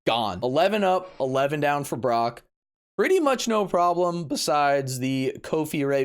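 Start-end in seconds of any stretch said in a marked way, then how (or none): none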